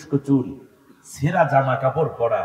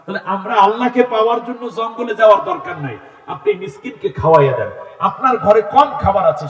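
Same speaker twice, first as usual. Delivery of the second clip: loud, raised-pitch exhortation; full-force preaching; 6 semitones higher, 8 dB louder than usual. Real, not acted. Preacher telling listeners to feed the poor, full-force preaching. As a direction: press the lesson on the crowd, loudly.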